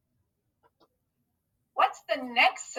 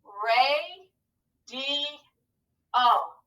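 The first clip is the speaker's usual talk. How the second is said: "Ray, D, O" stretched, with pauses between syllables; much slower than usual. Shown as a demonstration. Teacher proofing the word for pupils, syllable by syllable. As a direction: sound out "Ray, D, O" one held beat at a time. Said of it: "Let's scoop it to proof it".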